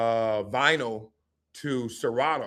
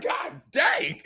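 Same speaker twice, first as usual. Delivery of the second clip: amazed; 12 semitones higher than usual. Retold or imitated. Team's amazed reaction.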